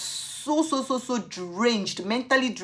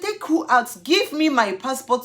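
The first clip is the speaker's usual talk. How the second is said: higher, louder demand; shouting back; 5 semitones higher, 4 dB louder than usual. Retold or imitated. Demanding her passport back, shouting. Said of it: "I said".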